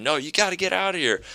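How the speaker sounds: high mock order out; a hint of a woman kicking him out. Woman throwing the man out, high mock demand. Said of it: "And she's saying".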